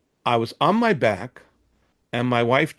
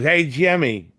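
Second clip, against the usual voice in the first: put-on drunk call out; 5 semitones higher, 5 dB louder, slower than usual. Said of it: "he's obviously drunk and stoned".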